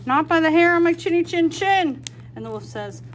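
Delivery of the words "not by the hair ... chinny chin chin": higher and louder, cheeky refusal; defiant pig voice. Acted. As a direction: stand up to the wolf. Pig defies the wolf.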